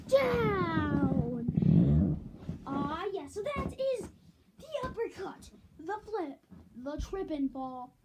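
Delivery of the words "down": loud drawn-out shout. Acted. Takedown move demo.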